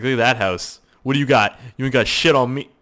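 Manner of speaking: mock gloating at the neighbours; boastful taunt. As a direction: brag at the neighbours' houses. Taunting neighbours about the house.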